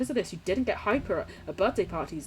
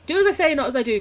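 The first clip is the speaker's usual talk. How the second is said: mock stern lecture; 7 semitones higher, 7 dB louder, faster than usual.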